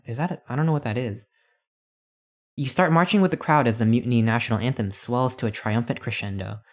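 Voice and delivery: ordinary delivery, nothing marked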